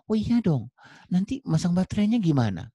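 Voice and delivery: high, animated mock protest; insistent customer. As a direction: argue back as a demanding buyer, pitch up. Acted customer insisting on manual. (pembeli ngotot, nada tinggi)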